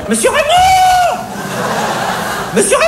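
very high shriek calling out; shrill cashier yelling for her boss; shouting for the boss. Retold or imitated. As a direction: scream the manager's name across the shop. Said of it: "hurla-t-elle".

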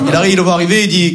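ordinary talk, nothing marked

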